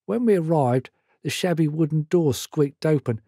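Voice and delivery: usual speaking voice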